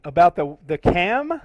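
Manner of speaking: loud confused echo "the cam?"; loud confused double-check on air; puzzled query. Real, not acted. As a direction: ask back loudly, not sure what was said. Mishears co-host, asks back.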